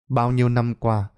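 ordinary delivery, nothing marked

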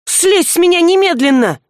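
high, sharp demand to get off; outraged girl, pitched high; indignant order. A narrator's reading. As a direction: push him away with the words.

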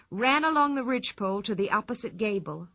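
plain reading voice

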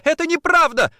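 high, loud, outraged denial; shout. A narrator's reading. Woman denies betrayal to accuser, shouting. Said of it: "закричала она".

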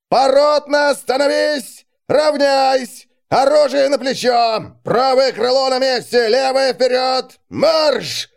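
high, loud drill commands; shouting drill sergeant. Acted. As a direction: bark the orders over the formation.

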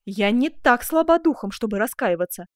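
loud, proud declaration; impassioned avowal; exclaiming. Acted. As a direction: declare it forcefully, then ease off. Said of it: "воскликнула герцогиня".

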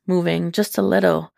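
ordinary delivery, nothing marked

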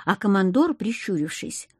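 plain reading voice

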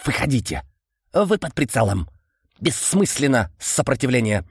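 clicking insect voice, chittering; стрекочущий голос. A narrator's reading. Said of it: "раздался странный стрекочущий голос". (стрекочущий голос насекомого)

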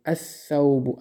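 الثوب said as-sawbu, lam silent, s doubled; الثوب as as-sawb with no lam; الثوب without the lam, s sound doubled; correct pronunciation. Shown as a demonstration. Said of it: "الثوب نمیگیم".

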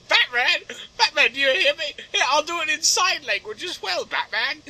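high, loud put-on voice; calling villain. Villain tries to reach Batman, high insistent call.

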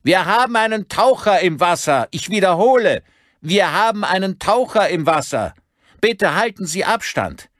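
high, loud roared announcement; megaphone bellow. A narrator's reading. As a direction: bellow it across the water as through a megaphone. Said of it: "schnappte er sich ein Megafon aus einem Schrank und brüllte zurück".

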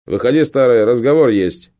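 shouted order through a door; loud command. Acted. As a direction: bark it loud and firm. Captain calls through the door.

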